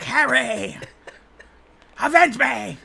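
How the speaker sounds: slow, high, hammy dying plea; overacted death scene. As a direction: drag it out like a dying villain. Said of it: "He's like".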